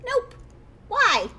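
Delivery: put-on high, bouncy little kid teasing the player. Ghost kid teasing the startled player, high little kid voice. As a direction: play a cheeky ghost kid pretending to be normal. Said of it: "I'll just give it a little kid voice".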